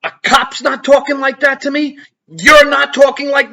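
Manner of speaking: retold defiance. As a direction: stand your ground out loud. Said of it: "I said".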